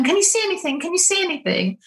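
high-pitched, insistent questioning; imitated eager onlookers. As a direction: mimic excited companions pressing for an answer. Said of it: "they're going".

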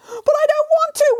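very high, whining wail; a cat wailing in protest. Acted. Cat refusing to jump, very high wail. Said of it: "wailed the cat".

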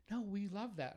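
high-pitched imitation of delighted clients; gushing clients. Clients' reassurance acted in retold exchange.

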